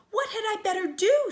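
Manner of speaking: high, loud, near tears; distressed cry. Acted. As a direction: fight back tears as you plead. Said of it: "she cried, trying to keep tears back".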